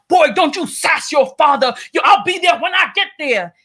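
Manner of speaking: mock father shouting back at his son; mock angry father. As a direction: bark it like a dismissive dad, half acted. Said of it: "And he's like".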